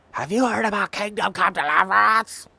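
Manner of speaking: high, loud eager gamer pitching a game; excited pitch. Pretend. Gamer cornering a stranger about a game, eager high pitch. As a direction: corner them, bursting to recommend it. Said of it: "regret asking him".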